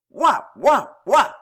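three loud, high barks; dog barking. A narrator's reading. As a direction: bark "woof" like a dog. Zerbin's barking taunt, minister's tic.

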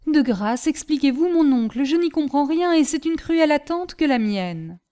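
bewildered, agitated plea; pleading. A narrator's reading. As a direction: beg impatiently for an explanation. Bewildered nephew begs for an explanation.

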